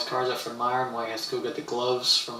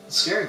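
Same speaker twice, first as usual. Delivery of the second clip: much higher and louder on "scary"; emphatic; 7 semitones higher, 3 dB louder than usual. Real, not acted. Streamer insisting getting in the ring is frightening, emphatic. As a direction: stress "scary" hard, going up high. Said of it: "'cause it's scary".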